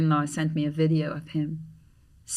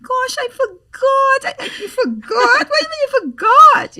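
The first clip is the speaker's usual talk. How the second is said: much higher, louder mock whine; whiny excuse; 10 dB louder than usual. Imitating her late friend's excuse, hammed-up whine. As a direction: wail the excuse like a guilty kid. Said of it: "He said".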